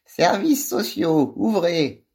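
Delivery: high, slow and piercing; a sharp-voiced official demanding entry; shrill official voice. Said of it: "une autre voix aigrelette enchaîne".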